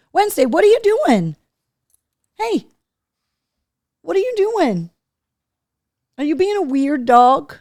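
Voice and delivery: high, sing-song talk to the dog. Real, not acted. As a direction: coo at the dog. Dog acting up.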